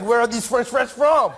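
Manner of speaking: hammed-up, high-pitched French indignation; baffled French accent. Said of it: "You guys also like".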